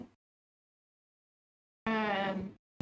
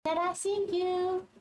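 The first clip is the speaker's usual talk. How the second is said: high-pitched cooing to a pet; playful high sing-song to a bird; talking to a pigeon; 8 semitones higher than usual. Pretend. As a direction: coo at a pigeon in a high little voice.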